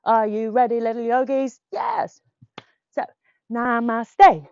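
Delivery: loud barked commands; playful sergeant. Pretend. Teacher playing sergeant for little yogis, loud. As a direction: command the kids like a jolly sergeant. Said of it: "I am the sergeant".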